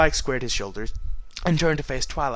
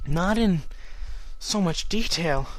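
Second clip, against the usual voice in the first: plain reading voice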